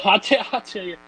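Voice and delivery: laughing through "I'll tell you" in a Southern twang; Southern accent, laughing. Acted. Cohost laughing while he keeps up the Southern accent. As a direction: giggle and keep the Southern accent going. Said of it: "went Southern there".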